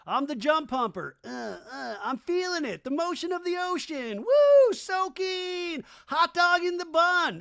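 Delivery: high-pitched, hammed-up whooping; mock excitement. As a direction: build to loud, silly cries of excitement. Mock soakers shouting in excitement.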